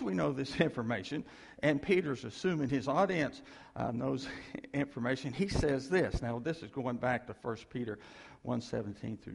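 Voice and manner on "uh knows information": ordinary talk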